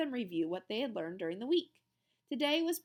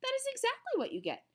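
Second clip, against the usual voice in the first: playful teacher; faster than usual. A narrator's reading.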